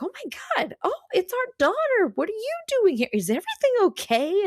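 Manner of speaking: very high-pitched, fluttery fussing; fussing family. Acted. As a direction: gush in a hammed-up high voice, all questions. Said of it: "making a fuss over her".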